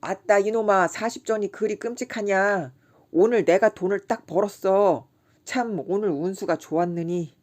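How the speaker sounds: loud, tipsy bragging; drunk boasting. A narrator's reading. Kim bragging loudly to his friend, drunk and boisterous. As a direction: brag loudly like a happy drunk.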